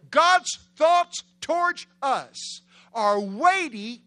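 high, loud, slow drawn-out proclamation; soaring preacher's shout; preaching at full force. Real, not acted. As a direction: cry the verse out to the crowd.

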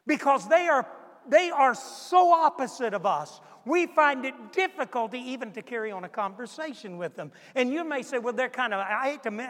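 loud, driving pulpit delivery; fiery preaching. Acted. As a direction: hammer the questions home to the congregation. Preaching at full force.

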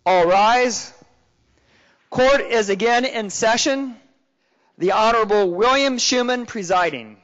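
loud, formal bailiff announcement. Acted. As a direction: call the court to order loudly. Bailiff opens the court session.

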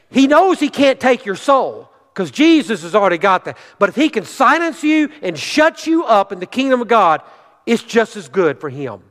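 high, urgent pulpit warning; fervent preaching. Acted. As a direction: drive each warning home from the pulpit. Preaches at full force.

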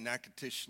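Natchitoches said as "NACK-uh-tish"; local pronunciation. Shown as a demonstration. Pastor explaining the Louisiana town's name, Natchitoches as "NACK-uh-tish". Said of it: "that's how they pronounce it down there".

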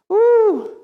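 high, loud "woo"; high whoop. Real, not acted. Preacher reacts to getting mad at spouse, high whoop. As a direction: let out a sustained high whoop.

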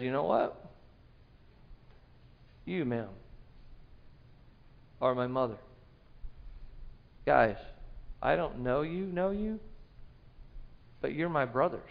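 soft, low, tender voice; Jesus tenderly naming the crowd as his family, low and soft; gentle and tender. Acted. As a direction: speak to each person as family, softly.

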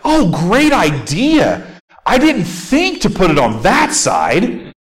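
sarcastic fisherman scorning the stranger's tip; mock sarcasm. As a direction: drag it out like a sneer. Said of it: "there's some tension, like, attitude".

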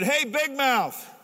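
high, loud, slow call across the barracks; drawn-out mock-tough yell; retold shout. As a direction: taunt him loud from across the room. Calling out to the bully.

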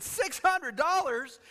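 slow, high disbelieving repeat of the price; shocked echo. Retold or imitated. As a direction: gasp at the price in mock shock. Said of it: "He said".

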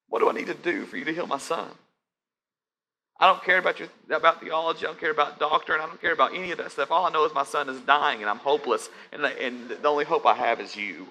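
a father pouring out his helplessness; desperate father. Acted. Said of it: "the desperation in the father's voice".

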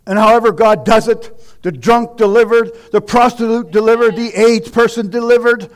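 rising preaching. Acted. Preacher lists the delivered to the congregation, loud. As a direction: hammer each deliverance home.

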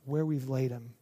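halting, heavy grief, fading low and soft; thick with emotion. Retold or imitated. Grieving sisters leading Jesus to the tomb, heavy and halting. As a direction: let long pauses weigh down each phrase. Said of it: "The emotion is thick".

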